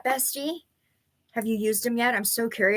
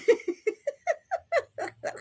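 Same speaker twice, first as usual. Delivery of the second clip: high giggle at own bad luck; rueful laugh; 4 dB quieter than usual. Real, not acted. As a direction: laugh it off, a bit exasperated. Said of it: "I have to laugh".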